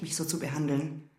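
ordinary talk, nothing marked

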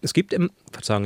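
talking with a mouthful; mouth full. Real, not acted. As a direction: speak through a bite of madeleine. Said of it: "ich spreche mit vollem Mund".

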